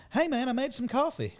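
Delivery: a hint of a friendly Southern host. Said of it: "He's like".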